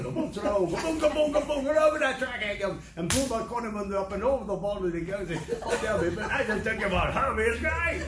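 high, breakneck comic Aussie bushie yarn; hammed-up, near-unintelligible Australian outback patter; Australian bush slang. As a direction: run it together in thick, excited Australian. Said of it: "they have their own language".

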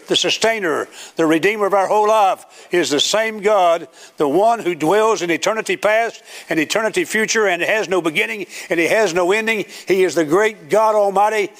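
exalting sermon crescendo; fervent preaching. Real, not acted. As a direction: build God's titles in sustained preacher cadence. Preaching builds on God's greatness.

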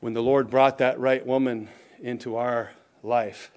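usual speaking voice

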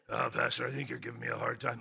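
hushed low croak; lost drill-instructor voice. Acted. Teased drill instructor answering pastor, voice lost. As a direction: strain out a weak, hoarse complaint. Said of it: "Pastor, do I sound weird".

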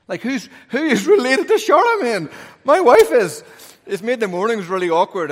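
high, loud, incredulous exclamation; mock astonishment. Real, not acted. Preacher marvelling to the congregation at his wife's royal line, high and loud. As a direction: play up the amazement for laughs.

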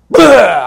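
loud imitated retch; mock throwing up. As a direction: blurt out a mock vomit noise. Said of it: "talking is like throwing up".

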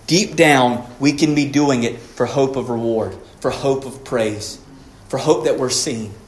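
ordinary talk

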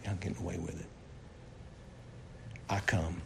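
low, soft, solemn voice of God; voice of the Lord. Acted. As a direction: speak as God, low and calm. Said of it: "what does the Lord say?".

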